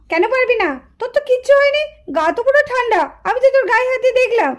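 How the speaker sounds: ordinary delivery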